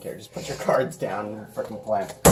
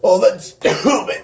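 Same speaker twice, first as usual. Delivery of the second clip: laughing; 8 semitones higher, 6 dB louder, slower than usual. Real, not acted. Laughing mid-game.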